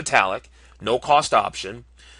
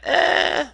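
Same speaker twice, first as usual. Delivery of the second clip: drawn-out, high-pitched noncommittal "ehh"; doubtful eh. Real, not acted. Reviewer balking at twenty-five hundred bucks, doubtful high eh. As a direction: squeak out a long, unconvinced "eh".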